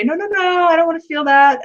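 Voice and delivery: half-hearted mock refusal; mock denial. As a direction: play someone pushing feelings away. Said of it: "suppress them and say".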